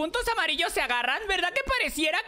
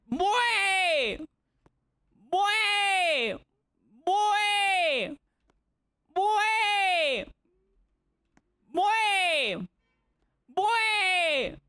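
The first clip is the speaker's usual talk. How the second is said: repeated game shouts; much slower than usual. Real, not acted. (gritos agudos y alargados, repetidos)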